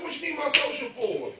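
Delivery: high-pitched mock protest; retold challenge.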